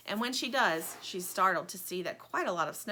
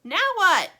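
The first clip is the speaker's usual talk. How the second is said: high, loud call from the window; 11 semitones higher, 13 dB louder than usual. Acted. Plastic calls to Lumpy in the snow, loud. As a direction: shout it down to the yard. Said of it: "calls Plastic".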